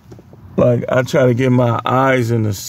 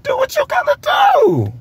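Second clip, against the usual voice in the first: squeaky high voice. Retold or imitated. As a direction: voice jumps way up into a squeak. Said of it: "his voice went up like a, he went up to alto".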